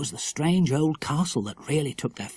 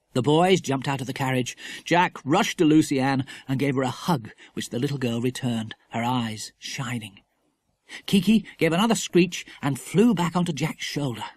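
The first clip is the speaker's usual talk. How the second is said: usual speaking voice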